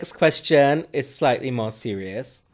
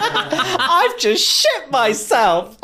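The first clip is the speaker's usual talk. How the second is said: high, loud, drawn-out mock announcement; hammed-up comic quote; 6 dB louder, much slower than usual. Quoting a favourite episode one moment, high drawn-out parody. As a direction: stretch it out high and loud for laughs.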